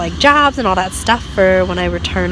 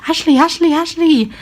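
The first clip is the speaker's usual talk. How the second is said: put-on higher, louder kid's repeated call; child calling out; 9 semitones higher, 4 dB louder than usual. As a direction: call the name like a cheeky kid. Kid calling wrong name.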